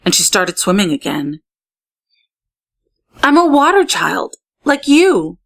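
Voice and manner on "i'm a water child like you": higher and bright with new resolve; energized. A narrator's reading. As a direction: declare it with a surge of energy. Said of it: "gave her fins a surge of energy".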